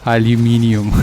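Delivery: "Aluminium" as al-yoo-MIN-ee-um; British pronunciation. Shown as a demonstration. Gamer trying out the British word, al-yoo-MIN-ee-um pronunciation. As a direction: give "Aluminium" a YOO and an extra ee before -um. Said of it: "You say, like, aluminium".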